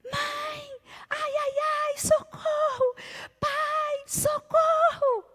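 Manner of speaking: put-on very high, piercing kid's cry of help; child screaming for help. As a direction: scream like a terrified little boy. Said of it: "escutam o Vitor gritando".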